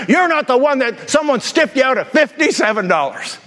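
imitating an irate churchgoer scolding her pastor; mock angry rant. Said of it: "give him a piece of her mind".

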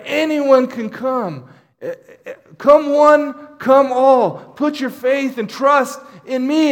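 loud, pleading call to everyone; crying out. Retold or imitated. Jesus calling the crowd to come, loud passionate cry. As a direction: call it out high and strong like a preacher. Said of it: "he's crying out".

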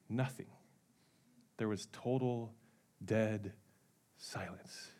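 very soft, low, word by word; hushed suspense. A narrator's reading. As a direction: let it die away into silence. Tells of sudden dead silence.

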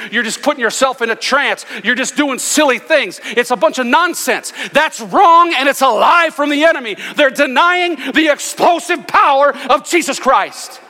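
high, loud pulpit preaching; fiery preacher denouncing the enemy; full-force preaching. Acted. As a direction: drive it home like a revival preacher.

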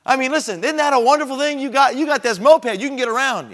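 pressing enthusiasm. Retold or imitated. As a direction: sell him on the gift of the moped.

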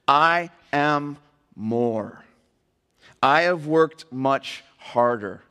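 very slow, word-by-word delivery; drawn-out, deliberate boast; emphatic declaration. Acted. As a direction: land each word separately and weightily. Climax of verse read aloud.

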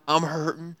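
ordinary delivery

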